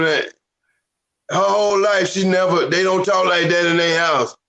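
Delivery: ordinary delivery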